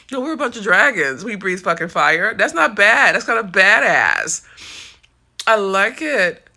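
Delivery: loud, proud declaration; fired up. Real, not acted. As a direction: claim the name with swagger. Embraces the name loudly.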